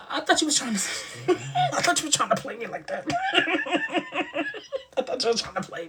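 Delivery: high-pitched, breaking into laughter; laughing. Real, not acted. Cracking up while teasing back.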